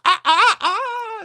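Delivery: high loud rooster cries; rooster sound effect. Retold or imitated. Radio sound effect of the rooster, loud high squawks. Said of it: "you'd hear the sound effect".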